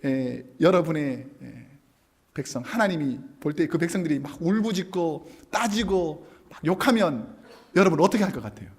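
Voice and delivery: usual speaking voice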